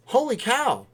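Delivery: high, loud amazed exclamation; surprised. Real, not acted. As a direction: react like you can't believe it. Reacting to big news.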